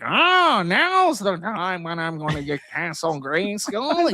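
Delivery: high-pitched, drawn-out mock villain whine; hammed-up loser villain; wimpy Skeletor. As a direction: play Skeletor as a slow, high wimp. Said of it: "Skeletor being, like, a wimp".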